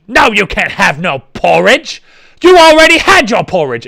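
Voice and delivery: mock British scolding, rising to a loud high cry; British orphanage master. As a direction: scold a hungry orphan, building in indignation. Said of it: "And it was a British".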